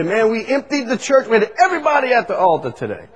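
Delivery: high, hurried mock pastor brag; boastful pastor. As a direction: imitate an excited pastor crowing over results.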